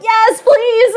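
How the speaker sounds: high, eager yes please. Retold or imitated. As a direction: jump at the pizza offer. Offered pizza after ruined cake.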